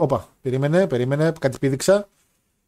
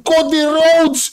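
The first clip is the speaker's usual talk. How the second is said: high, very slow name call; drawn-out cheer; 10 semitones higher, 3 dB louder, much slower than usual. Real, not acted. Fan hailing Cody Rhodes as a face, drawn-out high cheer.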